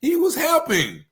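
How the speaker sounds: stunned bystanders shouting in disbelief; dumbfounded shout. Retold or imitated. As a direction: cry out that he was only helping. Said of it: "like, dumbfounded".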